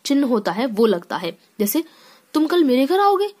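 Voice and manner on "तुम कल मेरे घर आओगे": amazed exclamation. Shown as a demonstration. Teacher showing students how surprise sounds, high amazed exclamation. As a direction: gasp it out as if you can't believe it. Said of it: "एक बोलने में ही अलग तरीका आ रहा है".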